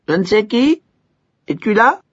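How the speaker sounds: appel haut et sonore. A narrator's reading. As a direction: appeler dans le vide. L'idiot appelle. (l'idiot appelle le serviteur, voix haute)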